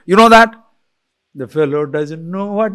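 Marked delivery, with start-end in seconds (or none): 0.1-0.5 loud, high, indignant demand; angry challenge. Acted. Person provoked by a doubter, angrily challenging. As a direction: throw it back heatedly. Acted denier exploding at the doubter.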